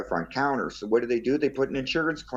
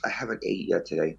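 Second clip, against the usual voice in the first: ordinary talk, nothing marked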